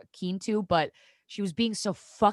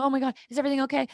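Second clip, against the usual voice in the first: retold alarm; 7 semitones higher, 3 dB louder, much faster than usual. Acting out her anxious phone pickup.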